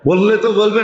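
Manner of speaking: ordinary delivery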